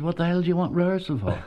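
quick mocking scoff at a naive young actor; incredulous tough boss. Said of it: "this terribly tough Dublin man called Fred".

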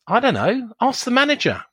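high-pitched, swooping protest; acted indignant deflection; mock brush-off. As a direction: plead ignorance and pass the buck in an exaggerated singsong. Acted brush-off of a question to the manager.

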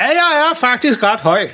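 langsomt spillet råb i højt leje; halvdøv, råbende far. Acted. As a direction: tal alt for kraftigt, som om du intet hører. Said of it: "du råber". (spillet råb som halvdøv)

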